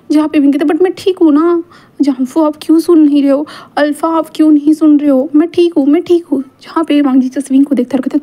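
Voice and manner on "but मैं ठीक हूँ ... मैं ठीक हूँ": lightly acted whining; whiny protest. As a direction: protest like someone begging to be believed. Wing protests he is fine.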